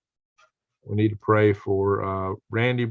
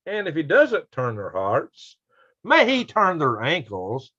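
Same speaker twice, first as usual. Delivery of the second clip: mock solemn blessing; 5 semitones higher, slower than usual. Reads a joke blessing from chat.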